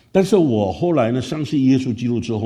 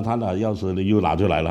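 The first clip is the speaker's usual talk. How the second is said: usual speaking voice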